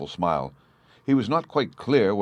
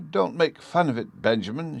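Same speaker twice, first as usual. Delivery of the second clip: pleading; 7 semitones higher than usual. A narrator's reading. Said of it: "she pleaded".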